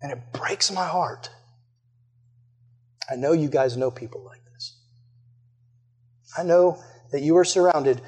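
quiet mournful sermon close; hushed sermon appeal. Acted.

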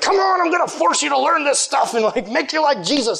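mock forceful leader, high and shouting; hammed-up bossy youth leader; pushy leader. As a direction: parody a leader forcing faith.

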